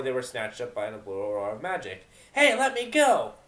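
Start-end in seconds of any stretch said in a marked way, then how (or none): 2.3-3.3 high, loud, slow struggling cry; loud protest. Acted. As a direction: yell to be let go. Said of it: "Vinyl protested".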